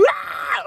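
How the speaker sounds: a fake sobbing gasp then a breathless ah; mock gasp. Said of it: "straight up screaming".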